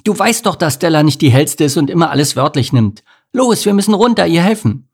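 high, loud, urgent scolding; urgent anger. A narrator's reading. Ant urging Bodo to help, urgent anger. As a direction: push him to act now. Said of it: "fauchte Amelie Bodo an".

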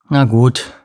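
grudging, grumbled consent; grumbling. A narrator's reading. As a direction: give in with a mild grumble. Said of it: "brummte er". (widerwillig gebrummt)

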